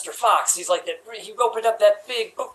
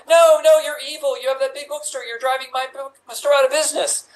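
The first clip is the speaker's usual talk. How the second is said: mock indignant; 6 semitones higher, 4 dB louder than usual. Heroine accuses Fox.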